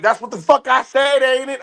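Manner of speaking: high, sharp indignant retort; the customer snapping at the agent's paraphrase, indignant. Retold or imitated. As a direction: snap back at the agent's question. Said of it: "she's like".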